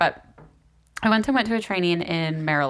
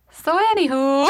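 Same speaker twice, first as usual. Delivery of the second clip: higher Wisconsin "so anywho"; Wisconsin guy's accent; 7 semitones higher, 4 dB louder than usual. Retold or imitated. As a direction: mimic a Wisconsin man filling a lull. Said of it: "the one guy would just go".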